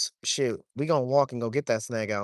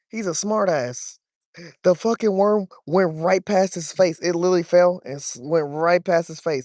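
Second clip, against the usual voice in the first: exasperated; 5 semitones higher, 6 dB louder than usual. Real, not acted. Fish snubs worm, frustrated.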